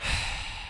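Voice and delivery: long unvoiced exasperated sigh; deep sigh. Real, not acted. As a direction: let the breath out, fed up. Walking away after being yelled at.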